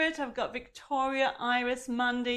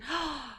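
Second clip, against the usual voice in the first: soft, short intake of breath; gasp; 3 semitones higher, 6 dB quieter than usual. Real, not acted. Host reacting to a daunted viewer, soft gasp. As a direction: gasp in mild dismay.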